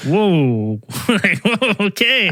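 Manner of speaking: high-pitched mock-startled whoa; taken aback. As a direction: back off from a threat, amused. Retold backing off after threat.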